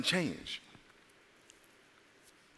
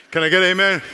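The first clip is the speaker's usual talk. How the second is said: loud, raised call to the congregation; 6 semitones higher, 11 dB louder, faster than usual. Real, not acted. Preacher asks the congregation for an amen, calling out. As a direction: throw it out loud for a response.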